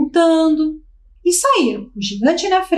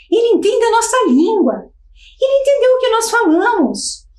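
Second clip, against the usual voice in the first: higher, excited crowd voice; the crowd thrilled he understands them; amazed townsfolk; 7 semitones higher than usual. Acted.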